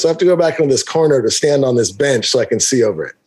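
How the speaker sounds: ordinary talk, nothing marked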